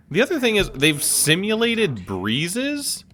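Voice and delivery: high, disbelieving question; can't believe what you just read; incredulous. Real, not acted. Streamer baffled by the ship's breezes, high and disbelieving.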